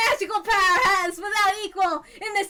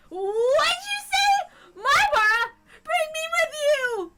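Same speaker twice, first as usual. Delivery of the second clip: desperate cry; 8 semitones higher, slower than usual. Acted. Character cries out in dismay.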